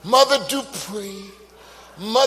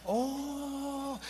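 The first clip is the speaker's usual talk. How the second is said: soft, high sustained "oh"; a quiet high wail welling up; rising cry coming up; 6 semitones higher, 16 dB quieter than usual. Retold or imitated. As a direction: imitate an involuntary cry, held back soft. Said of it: "I heard that coming up again".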